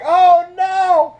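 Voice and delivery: high, loud laughing dismay; mock horror cracking up high. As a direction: shriek it in giggles. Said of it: "I was like".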